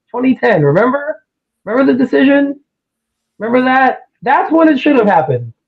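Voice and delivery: high-pitched disbelieving rant; worked-up exasperation at a pundit; incredulous rant. Real, not acted. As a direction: push it up high, can't believe this keeps coming up. Ranting at Max Kellerman.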